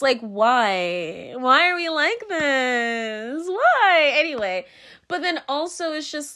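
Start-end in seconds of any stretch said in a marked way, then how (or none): 0.2-4.1 high, drawn-out plea of why; exasperated wail. Acted. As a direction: wail it slowly in mock despair. Mock despair at people.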